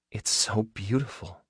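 slow, soft, awed hush; hushed wonder, as if afraid to disturb it. A narrator's reading. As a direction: breathe it out slowly, barely voiced. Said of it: "Her voice was hushed".

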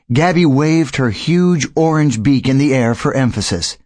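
ordinary delivery

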